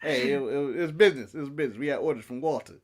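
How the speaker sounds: low, subdued mock Russian accent; half-hearted Russian henchman, quiet and deep; attempted Russian accent. Co-host voices a wrestler excusing the attack, faint Russian accent. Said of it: "gave a Russian accent".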